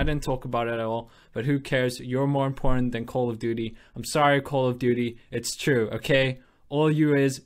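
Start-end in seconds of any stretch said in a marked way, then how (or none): none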